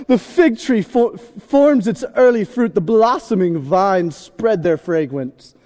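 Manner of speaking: slow, grand British recitation; British accent. Acted. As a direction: read it slowly in a put-on British accent. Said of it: "read this in a British accent".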